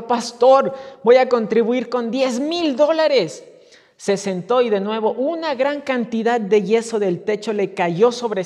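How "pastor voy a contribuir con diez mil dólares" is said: loud yelled offer to the pastor; shouted pledge. Retold or imitated. Rich man hit by plaster yells his bigger pledge, loud shout. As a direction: shout it after the plaster hits. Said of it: "el hombre gritó".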